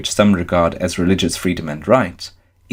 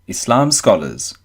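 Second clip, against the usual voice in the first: ordinary talk, nothing marked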